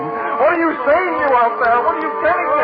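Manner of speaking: panicked cries to the voices outside; frantic terror. Acted. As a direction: beg the unseen voices for answers.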